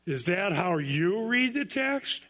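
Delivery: slow, incredulous "Is that how you read the text?", rising on "read"; ironic question. Shown as a demonstration. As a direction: ask it as a sarcastic challenge, slowly. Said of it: "You could say it this way. / ironic question".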